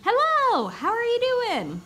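exclamation-mark hello, big and happy; excited greeting. Shown as a demonstration. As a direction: sing out the greeting like you're thrilled to see them. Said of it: "I want them to read it as".

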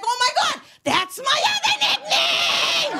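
high, loud, drawn-out delight; delighted squeal. Retold or imitated. Hearing New Orleans' nickname, high loud delighted squeal. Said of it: "I was like".

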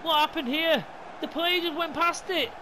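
high-pitched, excited disbelief; breathless elation. Real, not acted. Gamer reliving the final-minute winner, high-pitched elation. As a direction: gush over the win, still worked up.

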